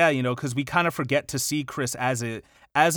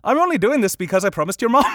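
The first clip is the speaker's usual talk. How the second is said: higher, louder, quicker Irish lilt; Irish accent attempt; 7 semitones higher, 6 dB louder, faster than usual. Shown as a demonstration. Podcaster retries Thomas's promise for co-host, high hammed-up Irish. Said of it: "what does an Irish person sound like".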